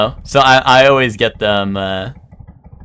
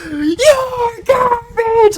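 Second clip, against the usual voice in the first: high, drawn-out screaming taunt; taunting shout; much slower than usual. Retold or imitated. Gamer taunting the player he killed with a drawn-out scream. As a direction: scream the insult like a troll. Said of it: "And I just went".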